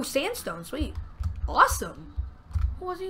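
ordinary delivery

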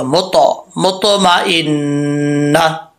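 मुतमइन्ना with a full, heavy ता and echoing stop. Shown as a demonstration. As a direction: stress the heavy ता in मुतमइन्ना, slowly. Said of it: "इसको पुर पढ़ना है". (मुतमइन्ना में ता को पुर पढ़ना)

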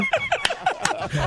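high, soft giggle; high embarrassed laugh. Real, not acted. Clerk admitting she suspected mumbling, high embarrassed giggle. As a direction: giggle at your own politeness.

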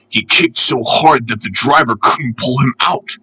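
ordinary delivery

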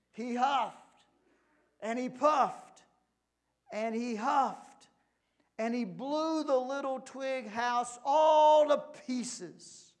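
high, loud and slow; dramatic narration. A narrator's reading. Narrator telling children of the wolf's blowing, loud and dramatic. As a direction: build the storybook climax, stretching key words.

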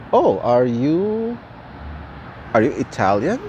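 ordinary talk

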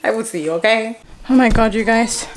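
ordinary talk

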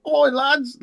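high, loud hammed-up British lad call; mock British lad. Orcs hailing their mates, mock British lad shout. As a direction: bark it like a rowdy British pub lad, British accent. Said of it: "the orcs in Games Workshop all talk like, like weirdo British guys".

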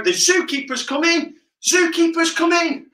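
high excited call of zookeeper's coming; shouted warning. Shown as a demonstration. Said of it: "And when I shout".

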